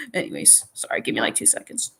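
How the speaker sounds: soft voiceless aside, stepping away; asking the chat to wait, in a whisper. Real, not acted. Whispered aside while busy.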